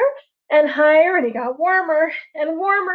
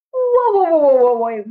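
startled bear; 3 semitones higher, 5 dB louder than usual. Acted. The bear sees the world falling apart, startled loud calls. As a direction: pull up short in alarm. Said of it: "was like".

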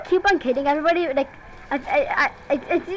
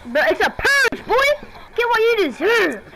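gloating taunt; 9 semitones higher, 4 dB louder, slower than usual. Pretend. Gloating purge announcement.

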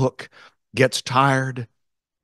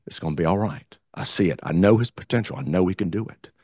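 ordinary talk, nothing marked

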